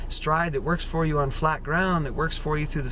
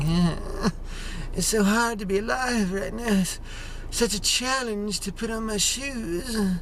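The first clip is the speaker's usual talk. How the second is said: miserable whine; 6 semitones higher, slower than usual. Retold or imitated. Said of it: "We're like".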